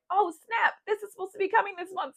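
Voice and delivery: high-pitched mock realization; retold surprise. Vlogger retelling the forgotten preorder, mildly startled.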